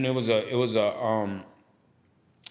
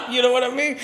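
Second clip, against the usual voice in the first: high laugh spilling into the words; real laughter at his dad; 11 semitones higher, 4 dB louder, faster than usual. Real, not acted. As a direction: laugh through it, pitched up. Laughing at dad's reply.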